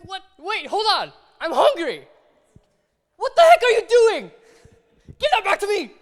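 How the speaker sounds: high, loud, indignant shout; angry outburst. Acted. Robbed hungry person shouts high and loud at the taker. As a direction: yell at the thief who grabbed your money. Victim whose bread money is taken.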